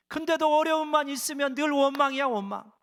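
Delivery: preacher chiding the congregation, exasperated and loud. Real, not acted. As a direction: throw up your hands at the endless complaining. Preacher mocking constant complaining.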